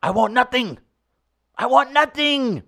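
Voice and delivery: Nigerian accent attempt. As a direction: try a Nigerian accent as in Concussion. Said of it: "think Will Smith in the movie Concussion".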